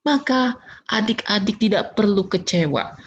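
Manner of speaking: normal talking voice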